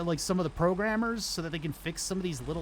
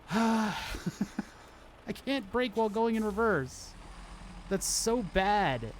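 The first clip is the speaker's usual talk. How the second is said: higher, exasperated sighing complaint; 6 semitones higher, slower than usual. Real, not acted. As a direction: complain with a weary sigh. Repeats complaint after a sigh.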